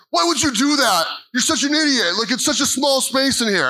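put-on high, whiny teen scolding; mock teen scolding. Brother scolds the other.

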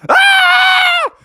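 very high, loud cartoon scream; panicked scream. Froggy realises he is naughty, panicked scream. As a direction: shriek in mock horror.

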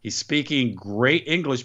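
ordinary delivery

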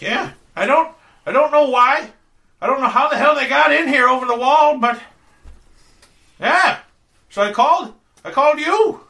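put-on high, loud old lady chatter; frail old woman rambling. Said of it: "this very old lady".

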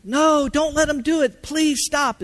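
high-pitched imitation of a pleading little boy; screaming, crying child. Son begging his daddy during the IV attempts, imitated crying out. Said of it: "and screaming and crying".

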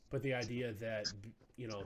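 ordinary talk, nothing marked

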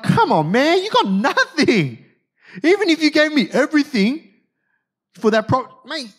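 high, incredulous scoff at a hopeless offer. Acted. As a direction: laugh off a rival's puny deal. Acted scoff at hopeless board-game deal.

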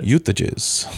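short mock Eastern European accent; Doctor Doom. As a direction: repeat it in the put-on villain accent. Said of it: "I've always read him with an accent".

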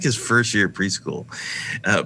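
ordinary talk, nothing marked

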